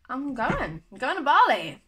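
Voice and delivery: high, loud burst of excitement; real excitement. Real, not acted. Telling viewers her dream trip is happening, excited. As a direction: let it burst out, swooping up high.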